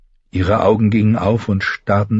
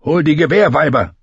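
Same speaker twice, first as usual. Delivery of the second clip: urgent command; 8 semitones higher, 4 dB louder than usual. Acted. Knight orders help, in pain.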